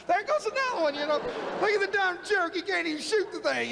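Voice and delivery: high, laughing jeer at a bad shot; laughing mock taunt. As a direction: cackle at the enemy who keeps missing. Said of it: "we were cracking up".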